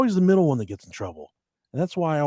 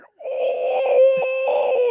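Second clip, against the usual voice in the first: sharp high cry of pain; 6 dB louder than usual. Retold or imitated. Boy hurt in the backside cries out high. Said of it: "and he's like".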